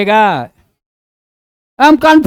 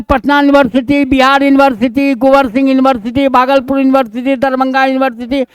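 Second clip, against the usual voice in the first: fast list; faster than usual. Real, not acted. Rattling off examples.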